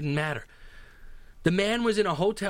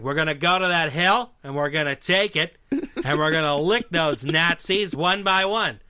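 John Wayne; 4 dB louder than usual. Acted. Podcaster mocking a star's war film lines, John Wayne impression. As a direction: do the same old swaggering war-hero voice. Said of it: "John Wayne not couldn't necessarily act".

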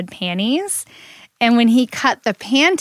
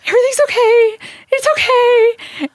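nervous reassurance; 6 dB louder than usual. Retold or imitated. Husband pretending to calm his wife while panicking, high nervous reassurance.

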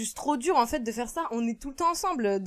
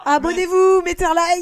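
hyped announcer; 8 semitones higher, 10 dB louder, slower than usual. Pretend. Podcast outro plug.

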